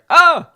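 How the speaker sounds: sudden high startled shout; startled yell. Retold or imitated. Hiding coworker startled awake in the aisle, yelping. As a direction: yelp like you were woken up. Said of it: "all I hear is, like".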